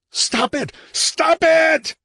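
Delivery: very high, loud shriek building on the repeat; throat-shredding shriek. Acted. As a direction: scream until the voice tears. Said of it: "he shrieked, his throat shredding".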